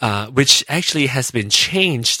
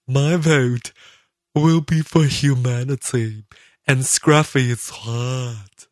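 listener voice; 2 semitones higher, 4 dB louder than usual. Retold or imitated. Podcaster voicing a fan's comment, slow put-on voice. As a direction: read it as a cheeky fan. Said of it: "this is my listener's voice".

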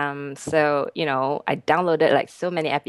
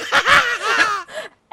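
laughing. Real, not acted. Laughing at the episode name.

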